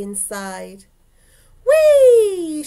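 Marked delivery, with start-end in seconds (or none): 1.7-2.6 loud, high, long whee, sliding down; gleeful whee. A narrator's reading. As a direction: squeal a giggly, joyriding whee. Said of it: "she cried".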